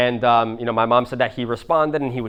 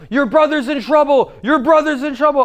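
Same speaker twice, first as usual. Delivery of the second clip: loud, very high imitated panic; mom freaking out; 6 dB louder than usual. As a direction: cry out the alarm like a frantic mother. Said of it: "so she's, like, freaking out".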